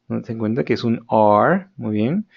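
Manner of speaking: usual speaking voice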